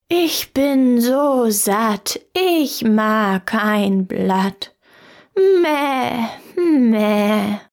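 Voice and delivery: very slow goat voice that ends in a bleating meh; a contented goat bleating its answer. Acted. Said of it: "Sie antwortete". (sehr langsame, meckernde Ziegenstimme)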